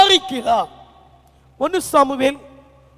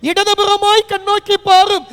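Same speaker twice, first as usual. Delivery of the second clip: lament; 6 semitones higher, 4 dB louder than usual. Acted. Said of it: "தாவீதை போல நீங்களும் நானும் புலம்பலாம்".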